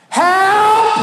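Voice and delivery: high, loud cry for help; shouted help. Retold or imitated. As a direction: scream help into the receiver. Shouting at the phone.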